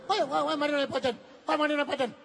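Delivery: put-on squeaky high child voice, aggrieved; high whining child. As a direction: whine like a hurt little boy. Child whining over mother's box.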